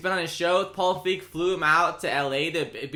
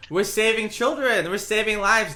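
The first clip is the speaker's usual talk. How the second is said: higher, louder hammed-up charity pitch; mock grandiose; 7 semitones higher, 6 dB louder than usual. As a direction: oversell the good cause in jest.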